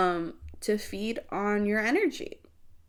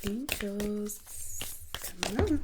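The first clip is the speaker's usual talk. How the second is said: quiet invocation; 10 dB quieter, slower than usual. Real, not acted. Calling on angels for message.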